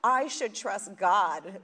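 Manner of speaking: loud laughing "oh" into a slow "I should trust God"; a loud laugh, then slow dawning words; laughing realization. Retold or imitated. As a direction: laugh as the truth lands. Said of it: "I was like".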